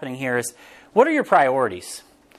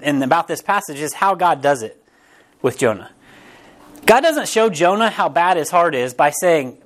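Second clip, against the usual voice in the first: usual speaking voice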